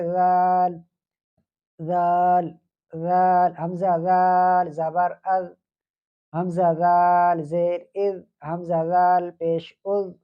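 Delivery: slow, measured drill of زال with its vowels; tajweed teacher drilling zaal; drilling light zaal. Shown as a demonstration. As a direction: spell out حمزہ زال زبر از slowly. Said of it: "یہ بھی باریک ہے".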